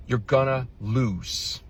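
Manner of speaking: very slow, each word hung out; slow, ominous closing line; slow drawn-out warning. Shown as a demonstration. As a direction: let it land slowly and heavily. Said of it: "slow final punchline".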